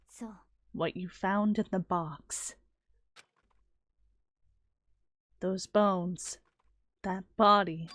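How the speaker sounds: low, soft, calm and guarded; a quiet, knowing warning; cool detective girl. Acted. Plays Kyoko in game.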